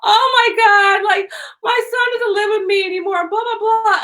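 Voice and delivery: hammed-up high complaining; complaining woman. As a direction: imitate a woman wailing about her son. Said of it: "she complained all the time".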